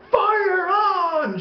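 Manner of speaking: high-pitched yelled warning; mock alarm shout. Dad waking his kid as a prank, mock alarm. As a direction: imitate a dad's false alarm yell.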